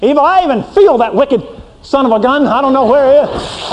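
high, scornful God driving out a sinner; angry holy God. Acted. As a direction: play an offended God ordering a sinner out. Preacher plays God rejecting sinner.

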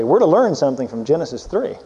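ordinary delivery

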